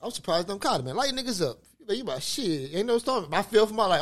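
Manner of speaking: usual speaking voice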